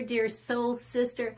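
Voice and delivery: ordinary talk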